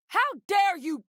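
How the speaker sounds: high-pitched outraged cry; offended, voice shooting up high. Acted. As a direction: let indignation leap right up in pitch. Acted outrage at an affront.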